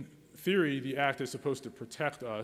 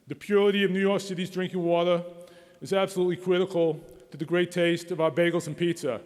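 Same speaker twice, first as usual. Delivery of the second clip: imitating a council member in a thick New York accent, higher and louder; 6 semitones higher, 9 dB louder than usual. Council member fretting about bagels and pizza, in a thick New York accent. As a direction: ham up a New York accent, pitched up and loud. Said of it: "he said in this thick New York accent".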